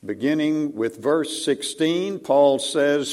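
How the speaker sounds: ordinary talk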